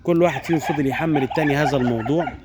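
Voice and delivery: ordinary delivery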